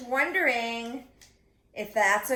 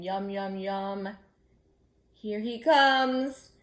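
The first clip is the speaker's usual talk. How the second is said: slow, louder, hungry wolf; 4 dB louder, slower than usual. Acted. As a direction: growl it like a greedy wolf. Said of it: "a wolf and he's saying".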